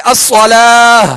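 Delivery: slow الصلاۃ ending in an h; ha-sakin stop. Shown as a demonstration. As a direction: say الصلاۃ as "as-salaah". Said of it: "آخر میں ہا کی آواز نکالیں گے".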